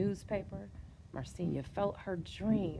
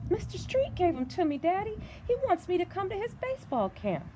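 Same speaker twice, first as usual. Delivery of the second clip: put-on much higher, eager girl pleading; little girl voice; 13 semitones higher than usual. A narrator's reading. Daughter eagerly telling her father about camp, high girl voice.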